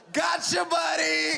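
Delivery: high, drawn-out teasing call; mock calling out. Driver to officer out the rolled-down window, high teasing call.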